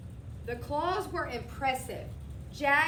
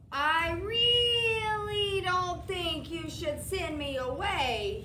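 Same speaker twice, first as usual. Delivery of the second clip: very slow, higher, louder ominous warning; 5 semitones higher, 4 dB louder, slower than usual. A narrator's reading. Mac warns the boy not to send him away. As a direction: lean in with a threat. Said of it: "he warned".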